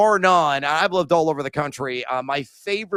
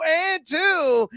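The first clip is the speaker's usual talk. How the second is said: playful hype chant; mock exclaim; 12 semitones higher than usual. Mock sing-song on 'and two'.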